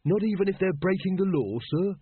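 put-on high-pitched, incredulous young constable; keen junior questioning an order; earnest recruit. A narrator's reading. Earnest recruit queries his captain.

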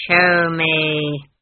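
loud, drawn-out cold order; icy command. A narrator's reading. Granny looms over Esk, icy commanding. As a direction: command it with ice in the voice. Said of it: "she commanded, and her voice had ice in it".

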